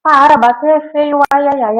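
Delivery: put-on high, loud scolding of a child; scolding toddler. Teacher scolding kids fighting at daycare, loud. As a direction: scold the biting toddler sharply. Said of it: "Ó, ó o repertório".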